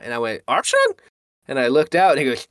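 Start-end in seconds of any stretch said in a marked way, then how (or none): 0.5-0.9 very high, rising questioning call; re-enacted high-pitched surprise; surprised query. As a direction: squeak the name as a question. Acts calling to friend.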